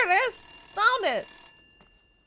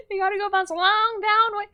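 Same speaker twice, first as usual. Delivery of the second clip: American accent; 3 dB louder than usual. Shown as a demonstration. Streamer showing off their best American accent, hammed-up. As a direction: lay on a hammy American accent. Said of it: "that's my best American accent".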